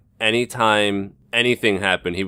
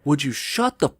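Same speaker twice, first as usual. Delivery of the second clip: high, furious snap at a heckler; imitating a fed-up fan telling a loudmouth off; angry retort; 10 semitones higher than usual. Friend Rachel turns round and tells the shouting guy off, high and furious. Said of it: "literally looks back and just goes".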